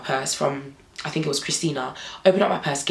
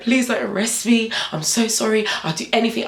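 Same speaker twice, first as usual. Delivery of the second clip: higher, whiny mock crying; imitated tearful pleading; 5 semitones higher than usual. Rider begs officers not to arrest, mock-tearful pleading. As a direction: plead as if crying, pitch raised. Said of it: "I'm crying like".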